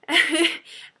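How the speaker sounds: loud, breath-led chuckle; quick self-amused burst; short laugh. Real, not acted. Laughing at finding accents interesting, short burst.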